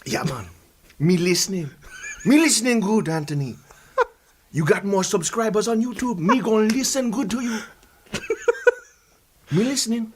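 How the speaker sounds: easygoing put-on Jamaican patois; fake Jamaican accent. Guest assuring host he listens, fake Jamaican accent. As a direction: play the agreeable Jamaican, lilting accent. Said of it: "this is not your real accent".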